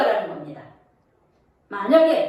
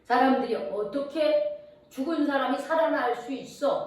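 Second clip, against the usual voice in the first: ordinary talk, nothing marked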